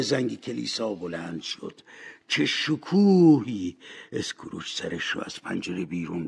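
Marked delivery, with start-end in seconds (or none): 2.3-3.8 awed exclamation. Acted. Scrooge marvels at the morning view.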